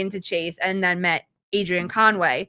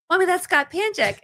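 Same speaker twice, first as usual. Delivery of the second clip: put-on much higher child voice pointing him out; excited little boy; 9 semitones higher than usual. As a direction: play the kid spotting his hero. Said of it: "he was like".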